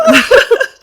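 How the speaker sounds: very high, loud laugh; real shrieking laugh; burst of laughter. Real, not acted. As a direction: crack up helplessly. Cracking up at themselves.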